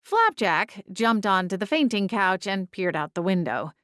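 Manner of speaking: ordinary delivery, nothing marked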